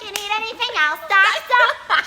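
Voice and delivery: high, loud vampy nurse purr; flirty nurse. Retold or imitated. Nurse offering the doctor anything, high vampy voice. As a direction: play the gum-chewing nurse coming on to the doctor. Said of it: "She's like chewing gum".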